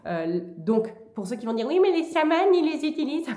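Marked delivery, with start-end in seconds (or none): 1.7-3.3 high, mocking duck-like squawk. Objector protesting that shamans use sage, duck voice. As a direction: mimic irritating people in a high duck voice. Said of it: "je prends toujours une voix de canard".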